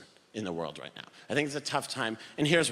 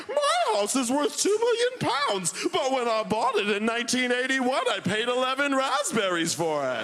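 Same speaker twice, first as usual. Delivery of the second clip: hammed-up smug homeowner; smug old person; 8 semitones higher than usual. Old person bragging about house prices, high mock voice. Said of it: "They're like".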